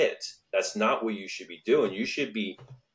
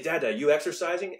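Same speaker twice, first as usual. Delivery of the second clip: put-on excited kid; 6 semitones higher than usual. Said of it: "they're getting the excitement factor".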